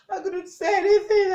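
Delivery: loud, high, crying protest; tearful outcry. Acted. Survivor who could tell nobody, loud high tearful outcry. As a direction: cry it out in despair. Despair at being unable to speak.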